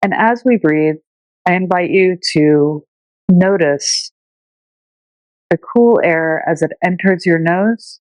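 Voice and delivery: unhurried meditation teacher voice with long pauses; calm, measured breathing-meditation delivery; guided meditation. Shown as a demonstration. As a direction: guide listeners gently through their breath, leaving space between phrases.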